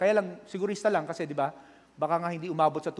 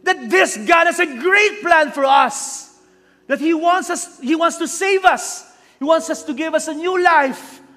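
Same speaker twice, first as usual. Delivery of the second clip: slow, much higher and louder shouted preaching; preaching at full force; 12 semitones higher, 12 dB louder, slower than usual. Acted. Pastor shouting at his online flock, full force preaching. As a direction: thunder the question at the congregation.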